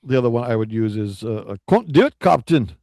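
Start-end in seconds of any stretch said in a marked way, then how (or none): 1.5-2.7 loud, high Scottish engineer; Scotty. Retold or imitated. Co-host quoting a starship engineer to his captain, loud Scottish protest. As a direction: strain upward in a hammed-up Scottish accent. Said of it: "A little Scotty".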